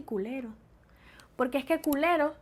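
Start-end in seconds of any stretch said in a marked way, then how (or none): 0.0-0.5 much softer, baffled echo; stunned shopgirl. Acted. Shopgirl left speechless by the word, hushed. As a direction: echo it under the breath in disbelief. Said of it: "la muchachita se iba a quedar así".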